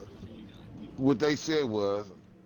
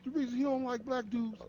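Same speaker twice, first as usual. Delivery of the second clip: high-pitched imitation of an offended man; mock indignation; 9 semitones higher, 3 dB quieter than usual. Retelling what pissed the man off, high mock indignation. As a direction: pitch it way up, quoting his grievance. Voicing the offended man's complaint.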